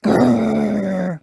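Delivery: put-on loud toddler dinosaur growl; George's growl. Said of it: "says George".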